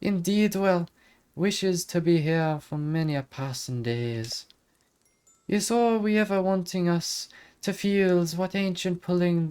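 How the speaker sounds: high lilting Irish fairy voice; Irish accent. Acted. Fairy character voice continues.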